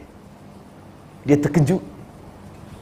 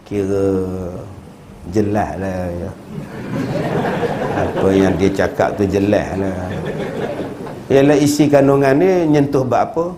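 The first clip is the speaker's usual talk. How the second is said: lightly voiced mumbling husband; 10 semitones lower than usual. Husband mumbles vague answer.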